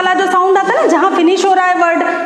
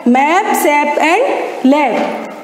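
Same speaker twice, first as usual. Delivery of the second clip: very slow, spacing out मैप, सैप and लैप; slow spoken demo; 2 semitones lower, much slower than usual. Shown as a demonstration. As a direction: say मैप, सैप and लैप slowly, one by one, for the class. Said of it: "मैं बोल के बता देती हूँ एक बार". (मैप, सैप और लैप को बहुत धीमे, अलग-अलग बोलना)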